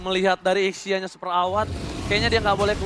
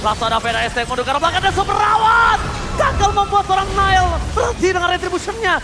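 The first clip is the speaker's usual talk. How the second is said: excited play-by-play; 9 semitones higher, 5 dB louder than usual. Acted. Hype play-by-play burst.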